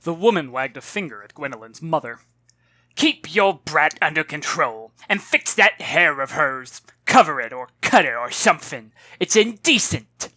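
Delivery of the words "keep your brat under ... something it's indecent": indignant scolding. Acted. Rude woman scolding Gwendolyn's mother, loud and haughty. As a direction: look down your nose at them.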